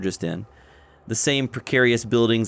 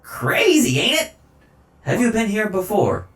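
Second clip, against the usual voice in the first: high odd voice; 11 semitones higher than usual. Acted.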